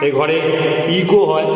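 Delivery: echoey empty room. Real, not acted. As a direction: voice ringing in a bare room. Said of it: "বাইরে থেকে কথা বলছি আমি তো, তাই ইকো হচ্ছে".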